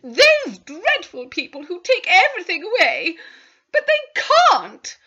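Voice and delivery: high, loud, frantic protest; wife panicking that everything will be taken; panicked. Acted. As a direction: wail it in terror, stretching the last words. Wife panics over the bailiffs.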